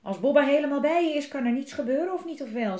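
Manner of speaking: loud, high, cocky boast; big talk from a little hero; tough bravado. A narrator's reading. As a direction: puff yourself up and brag. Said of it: "zei Bobba stoer".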